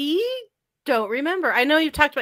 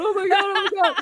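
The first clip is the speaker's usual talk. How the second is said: high-pitched mock freak-out through laughter; imitated frantic reaction; 10 semitones higher, faster than usual. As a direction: imitate someone overwhelmed, nearly laughing. Imitating man rolling around overwhelmed.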